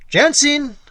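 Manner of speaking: high, loud shout of a name across the tavern; loud call. Acted. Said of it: "cried in a loud tone".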